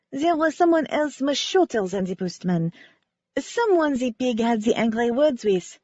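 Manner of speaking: lightly voiced French ballerina, sincere; a hint of French accent, remembering gratefully. Ballerina Cece telling the amateur sleuth how Wynne saved her, light French accent. Cece's voice continues.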